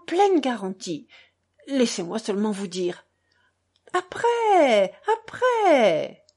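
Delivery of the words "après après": brushing him off, a touch higher; impatient lady cutting him short; dismissive. Acted. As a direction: wave the words away, after, after. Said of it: "en agitant la main".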